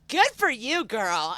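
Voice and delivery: high, loud mock congratulation; hearty cheering on. Businesswoman praising the jacket's wearer, loud cheering approval. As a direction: cheer her on, starting high and dropping.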